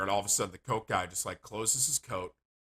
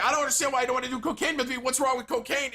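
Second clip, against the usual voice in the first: high-pitched, rapid-fire agitated demand; mock aggressive; 4 dB louder, faster than usual. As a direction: imitate a worked-up guy badgering someone. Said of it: "starts to get aggressive".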